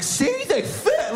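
squealed high and drawn out; slow, very high showing-off squeal; high mock squeal. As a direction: stretch it out in a silly high voice. Acting out his imagined video.

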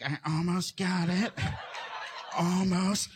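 slow, strained, drawn-out words; concentrating little brother. Retold or imitated. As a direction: imitate a kid straining to reach something. Brother fishing for prize in box.

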